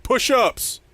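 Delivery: loud, high-pitched holler; heckling fan yell. Retold or imitated. Imitating fans after dropped passes, loud high yell. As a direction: shout it like a drill-happy fan in the stands. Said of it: "they'll yell at them".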